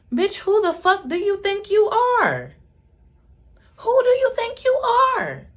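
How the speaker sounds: shrieking very high and very loud; screaming. Retold or imitated. Mother screaming at brother who overstepped. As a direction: explode at him.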